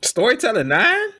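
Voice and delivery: high-pitched, disbelieving question; incredulous. Real, not acted. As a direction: shoot way up in pitch, can't believe it. Disbelief at co-host's score.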